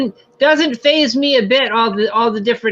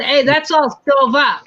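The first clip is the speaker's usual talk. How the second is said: drawn-out, louder Maine dialect; Maine regional accent; 4 semitones higher, 3 dB louder than usual. Acted. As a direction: say it like a local up in Maine. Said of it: "up in Maine that they don't say".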